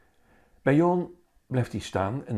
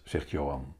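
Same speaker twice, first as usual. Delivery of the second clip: plain reading voice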